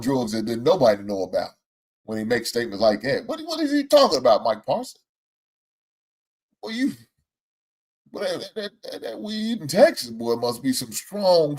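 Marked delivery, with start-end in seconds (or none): 3.3-5.0 high incredulous disbelief; can't believe what he's hearing; sky-high what-is-he-talking-about. Real, not acted. Incredulous outburst at Parsons. 6.6-10.1 high, stammering mock bewilderment; high stuttering ridicule. Real, not acted.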